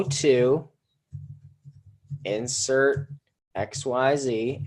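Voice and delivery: each chunk spaced out with long pauses; slow, deliberate dictation of a template. Shown as a demonstration. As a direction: spell the formula out slowly, piece by piece. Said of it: "here's the equation for you guys".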